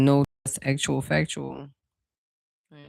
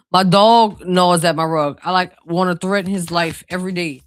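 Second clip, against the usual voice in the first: exasperated; 3 semitones higher, 7 dB louder than usual. Real, not acted. Exasperated about her dog.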